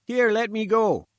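high, loud, struggling sputter. A narrator's reading. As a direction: splutter it while wriggling free. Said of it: "he sputtered".

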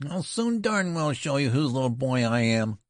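put-on high-pitched ferocious boy. A narrator's reading. Boy retorting to the colonel, ferocious. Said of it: "in a ferocious voice".

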